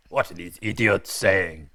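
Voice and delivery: loud hammy French voice; mock French accent. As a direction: play a baffled Frenchman.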